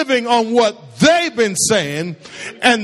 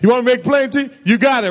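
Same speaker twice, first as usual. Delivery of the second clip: normal talking voice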